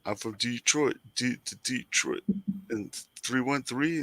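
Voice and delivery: light rap-style Detroit hype; Detroit rapper voice. Retold or imitated. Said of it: "they would always talk about".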